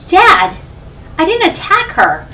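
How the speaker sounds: high, insistent denial to a father; retold pleading denial. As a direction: replay a teenager protesting innocence. Retelling her denial to dad.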